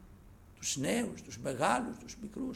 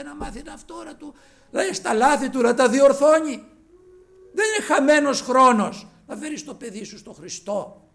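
louder, higher fervent exhortation; preaching at full force; 10 semitones higher, 11 dB louder than usual. Acted. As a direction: preach it out to the congregation. (δυνατό κήρυγμα)

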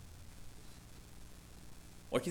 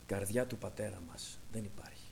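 hushed, breathless whisper, much lower and softer; 8 semitones lower, 11 dB quieter than usual. Real, not acted. Preacher whispers a grave truth to his brothers and sisters. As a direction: breathe it out as a secret.

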